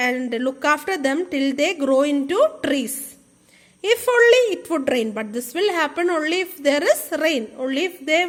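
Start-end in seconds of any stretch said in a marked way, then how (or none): 3.9-5.1 longing wish. Acted. Teacher voicing hopeful villagers.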